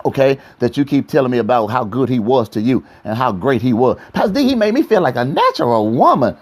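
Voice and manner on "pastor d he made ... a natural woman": mocking woman voice. Imitating woman praising ex.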